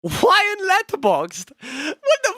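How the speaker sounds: high, loud incredulous outcry; loud disbelief. Real, not acted. Streamer reacting to Letterboxd, high and incredulous. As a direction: cry out in outraged disbelief.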